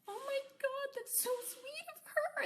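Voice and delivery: very high, slow, teary imitation of a moved pet; touched, teary. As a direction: squeak it out like an animal about to cry. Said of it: "they'll, like, cry".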